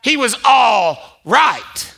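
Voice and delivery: slow shouted punchline; emphatic preach. Acted. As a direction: land it hard and slow.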